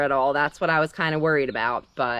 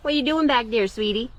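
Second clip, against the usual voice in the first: pet talk; 6 semitones higher than usual. Real, not acted. Talking to her rabbit.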